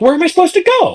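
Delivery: very high-pitched retold exasperation; exasperated protest. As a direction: imitate a cornered driver's baffled protest. Acts out driver's exasperated reply.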